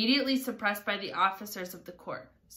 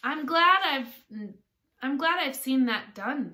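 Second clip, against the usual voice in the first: put-on higher, louder girlish voice; raised, eager exclamation; pleased Alice; 6 semitones higher, 7 dB louder than usual. A narrator's reading. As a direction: voice Alice's satisfaction brightly up high.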